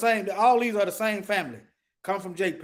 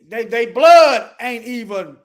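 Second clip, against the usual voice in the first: louder, slowed insistence on blood; emphatic; 10 dB louder, slower than usual. Real, not acted. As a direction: hammer home the word blood.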